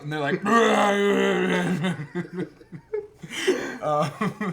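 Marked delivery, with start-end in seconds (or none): 0.5-3.8 loud, high alien roar trailing into laughter; hammed-up rolling creature bellow; imitated alien growl. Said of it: "they make, like, growling noises".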